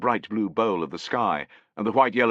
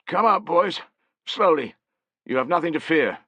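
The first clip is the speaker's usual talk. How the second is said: put-on high, louder old man's call; 10 semitones higher, 5 dB louder than usual. A narrator's reading. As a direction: coax the boys out gently. Old man calls grandsons out.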